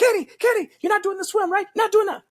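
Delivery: high-pitched fretting mother voice; worried mom. Retold or imitated. Mom on the phone begging her son to skip the swim, high and fretful. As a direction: squeak it out like an anxious mom. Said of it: "My mom calls me first".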